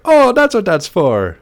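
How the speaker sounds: high, slow delivery guy voice that drifts Irish; a Jamaican accent that keeps turning Irish; failed Jamaican accent. Retold or imitated. Retelling the delivery guy in a failed Jamaican accent. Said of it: "it is Irish".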